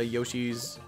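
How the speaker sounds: normal talking voice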